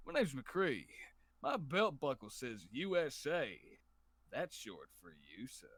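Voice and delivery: slow, low cowboy drawl; McCree impression. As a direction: drawl it like a gunslinger. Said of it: "I always main McCree".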